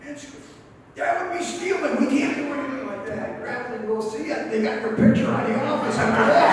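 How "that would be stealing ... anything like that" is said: a lightly voiced young clerk, pitched much higher; hint of a prim clerk, much higher; mock prim clerk. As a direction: play the shocked girl, much higher. Girl from office refuses.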